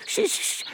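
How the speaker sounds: unvoiced hissing shush; urgent shushing; hushing shh. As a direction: silence him quickly. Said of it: "μίλα πιο σιγά".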